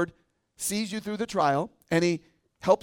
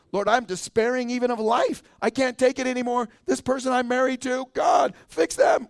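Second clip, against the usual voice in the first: desperate prayer; 6 semitones higher, 5 dB louder than usual. Acted. Believer at the end of their rope crying out to God, building to a shout. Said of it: "And you feel like Moses or Elijah or Paul".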